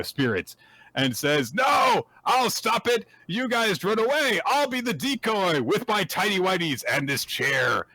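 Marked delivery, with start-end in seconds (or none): 1.6-7.9 high, resolute mock shout; hammed-up brave hero; heroic stand. Voicing Zeno's heroic stand.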